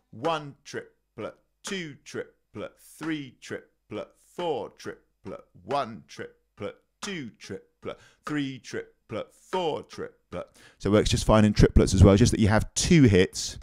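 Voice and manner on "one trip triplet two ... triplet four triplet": one triplet, two triplet counted softly and slowly; quiet slow triplet count. Shown as a demonstration. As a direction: murmur the triplets under the playing. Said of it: "I'll demonstrate that now".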